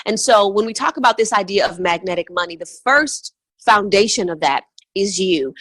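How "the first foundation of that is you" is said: ordinary talk, nothing marked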